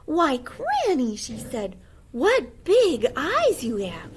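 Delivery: put-on high, slow, amazed girl; wondering little girl.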